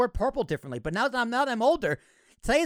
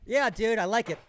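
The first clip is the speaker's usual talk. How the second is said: ordinary delivery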